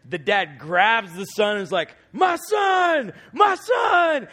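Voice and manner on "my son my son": much higher, louder overjoyed shout; father shouting with joy as he hugs his son; joyful cry. Retold or imitated. Father's ecstatic reunion cry.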